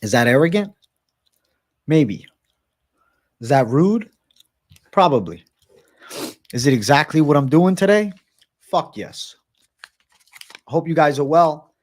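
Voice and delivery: talking while chewing gum; words around a wad of gum. Real, not acted. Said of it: "with a piece of gum in my mouth".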